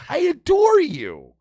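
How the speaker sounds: slow, high, gushing I adore you; syrupy mock devotion; mock adoration. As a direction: pour on fake sweetness, slowly. Mock affection to rival comics.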